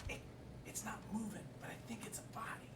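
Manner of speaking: hushed whisper, breathy and scared. Acted. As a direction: whisper it, spooked. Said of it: "why are you whispering?".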